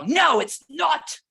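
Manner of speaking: imitated angry protest, high and loud; angrier child. Acting out an angry child refusing the adult's guess, loud protest. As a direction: act out a child's loud, drawn-out denial. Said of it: "they'll just get angrier".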